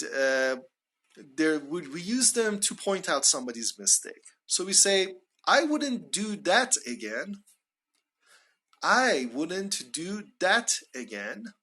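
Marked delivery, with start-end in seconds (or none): 5.5-11.5 slow, higher model phrase leaning on "that"; stress on THAT. Shown as a demonstration. Teacher pointing out somebody's mistake, stress on "that". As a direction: punch "that" with a pitch peak. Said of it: "We stress that".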